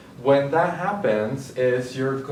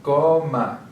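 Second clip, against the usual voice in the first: "Coma" with a louder, drawn-out first syllable; stressed syllable shown; 2 semitones higher, 5 dB louder than usual. Shown as a demonstration. Teacher showing where the stressed syllable is, "Coma" stressed loudly on "Co". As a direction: lean hard on the "Co" of "Coma". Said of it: "where the stressed syllable is".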